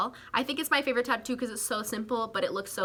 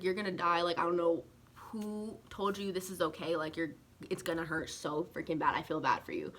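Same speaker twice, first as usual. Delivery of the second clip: deadpan mock warning, softer and lower; sarcastic; 4 semitones lower, 6 dB quieter than usual. Tattoo artist teasing a nervous first-time client, sarcastic. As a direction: imitate someone teasing with low, quiet fake sympathy. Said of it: "He was being sarcastic".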